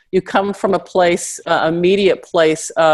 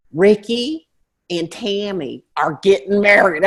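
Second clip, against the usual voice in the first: slow, drawled Southern gossip; Southern accent; 2 semitones higher, slower than usual. Retold or imitated. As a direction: drawl out the big news Southern style. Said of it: "you start getting that accent".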